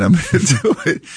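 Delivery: real embarrassed chuckle breaking into the speech; self-deprecating laugh. Real, not acted. Laughs at own naive plan.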